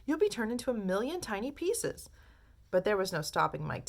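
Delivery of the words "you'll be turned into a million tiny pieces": frantic call. A narrator's reading. Mother calling her son back, frantic and high. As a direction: beg him to come back. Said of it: "screamed Missus T V".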